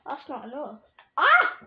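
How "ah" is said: sharp high yelp of surprise; startled yell. Real, not acted. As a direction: yelp as if suddenly hit. Sudden fall or hit in game.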